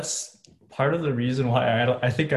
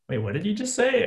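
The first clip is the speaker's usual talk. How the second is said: higher-pitched mock confusion; imitated puzzlement; 9 semitones higher than usual. Retelling grandparents' reaction to a mispronounced word, puzzled. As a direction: query it like a baffled listener, pitch up.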